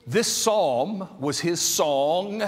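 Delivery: ordinary delivery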